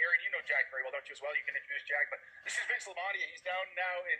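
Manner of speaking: usual speaking voice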